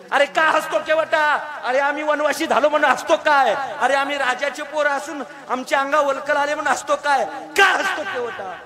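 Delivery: loud, high pleading demand; demanding question. Acted. Ram demanding why the ferryman laughs, loud and high. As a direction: press him hard for an answer. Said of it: "असं रामप्रभूनं केवटाला विचारल्यावर".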